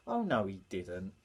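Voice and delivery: low scoffing retort; doubting classmate. Acted. A classmate scoffing at Tiddler's tale. Pantomime call-and-response.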